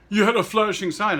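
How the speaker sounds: high, loud mock yell at the pedestrian; retold yelling. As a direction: bark it out like an angry driver, imitating. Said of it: "he starts yelling at me".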